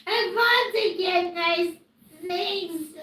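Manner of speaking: whiny tearful plea; crying. Real, not acted. Streamer begging viewers for nice comments, crying. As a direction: plead weepily, rising into a wail. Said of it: "I'm crying".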